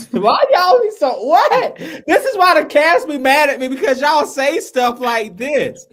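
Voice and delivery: high, loud laughing protest; laughing complaint. Real, not acted. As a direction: laugh it out in disbelief. Laughing exasperated complaint to cast.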